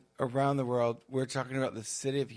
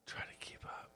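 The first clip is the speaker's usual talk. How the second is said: whispering; 17 dB quieter than usual. Real, not acted. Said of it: "I'm trying to".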